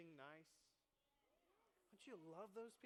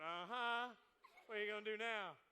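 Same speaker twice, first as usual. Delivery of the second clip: louder, teasing uh-huh; smug husband; 10 dB louder than usual. Retold or imitated. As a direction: needle him with a smug challenge. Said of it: "her husband is sitting next to her going".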